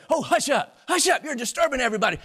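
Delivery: high, loud, fast scolding; annoyed crowd. Acted. Crowd telling the blind beggar to hush, annoyed scolding. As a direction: shush him impatiently.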